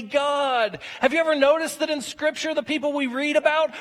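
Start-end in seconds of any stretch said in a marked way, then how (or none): none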